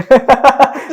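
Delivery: big real laugh, high and loud; loud burst of laughter. Real, not acted. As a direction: burst out laughing at mom's question. Mom asks what 10k gives. (ज़ोर का ठहाका)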